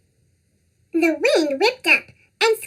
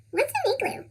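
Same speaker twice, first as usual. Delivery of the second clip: squeaky cartoon voice; 7 semitones higher, 8 dB quieter, faster than usual. Child asking question.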